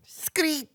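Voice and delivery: high, small squeaky screech; favorite screech noise. Shown as a demonstration. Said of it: "that's my favorite noise".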